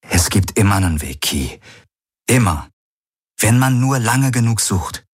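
mild, steady resolve; understated confident insistence; determined, confident. A narrator's reading. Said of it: "entschlossen und zuversichtlich".

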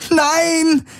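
high-pitched scream of protest. A narrator's reading. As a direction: scream in desperate refusal. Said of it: "schrie Kirai". (verzweifelter hoher Schrei)

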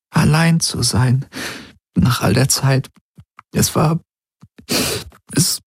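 high, halting, breaking off; struggling to go on. Acted. Woman confides her loneliness, struggling to go on. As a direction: falter between phrases until the words give out. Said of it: "sie holte tief Luft".

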